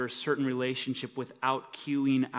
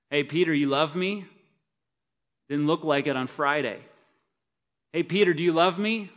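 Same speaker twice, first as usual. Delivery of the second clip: louder, mocking taunt of a failed friend; taunting Jesus; 4 semitones higher, 8 dB louder than usual. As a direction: rub it in his face, jeering. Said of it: "is he just rubbing Peter's face in his own failure".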